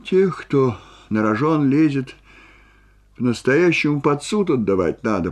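usual speaking voice

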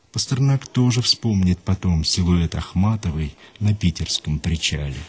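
ordinary delivery

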